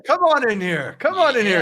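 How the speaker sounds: put-on high, lilting old relative's welcome; welcoming grandfather. Grandfather waves Enzo into the back room, effusive and high. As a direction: usher a grandson in with swooping enthusiasm. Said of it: "your grandfather".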